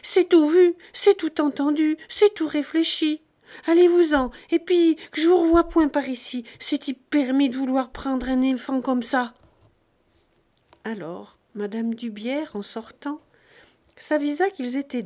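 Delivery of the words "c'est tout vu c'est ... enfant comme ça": much higher and louder, cutting in; exasperated shout. A narrator's reading. Farm wife cutting off the rich couple, furious. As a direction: throw them out, shouting. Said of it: "exaspérée, lui coupa la parole".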